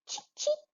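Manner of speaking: very high, soft cheep; teacher chirping for the class; bird chirp imitation. Shown as a demonstration. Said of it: "what is the bird sound?".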